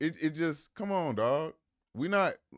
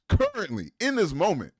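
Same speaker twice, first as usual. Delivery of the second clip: emphatic stress; 7 semitones higher, 8 dB louder than usual. Real, not acted. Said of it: "emphatic point".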